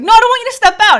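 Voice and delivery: high, loud objection; retold panicky plea to stay; alarmed protest. Retold protest to departing doctor.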